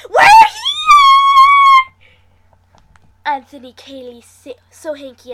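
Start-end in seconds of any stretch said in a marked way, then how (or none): 0.1-1.9 much higher, much louder shrieking yell; screaming. A narrator's reading. Friends screaming into the phone, all-out shrieking yell. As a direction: scream it down the phone at full blast. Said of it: "scream into the phone".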